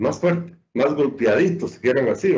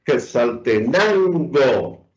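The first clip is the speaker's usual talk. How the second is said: Quetzaltenango slowly spaced out, leaning on "nan"; stress on penultimate syllable; much slower than usual. Shown as a demonstration. Teacher reads out an example, Quetzaltenango stressed on "nan". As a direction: stretch Quetzaltenango, weight on "nan". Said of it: "lo que está en rojo es la sílaba con mayor".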